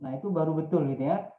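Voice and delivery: ordinary talk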